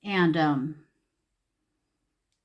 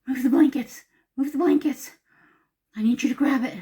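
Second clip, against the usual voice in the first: high, loud, urgent retold plea; hushed-breath panic turned into pressing demands; panicked urging; 6 semitones higher, 5 dB louder than usual. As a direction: act out frightened orders to a partner. Retold panicked orders to husband.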